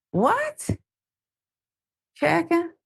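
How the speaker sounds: can't believe what he just read; incredulous. Real, not acted. Host reacting to the chicken insult, stunned disbelief.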